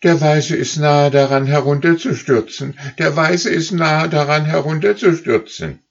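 high, loud calling goose voices; high, loud geese crying out. Acted. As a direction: shout the warning up the line. Rear geese report.

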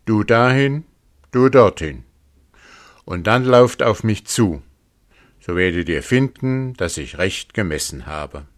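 a wolf barking out commands; wolf calling out. A narrator's reading. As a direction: order the rams into place loudly. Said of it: "rief der Wolf".

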